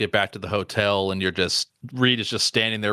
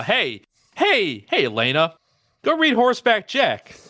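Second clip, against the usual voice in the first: higher, eager teasing call; calling out teasingly; 9 semitones higher than usual. Retold or imitated. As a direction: call out to Elena with glee, voice jumping up. Calling out to Elena.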